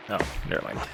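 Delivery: ordinary talk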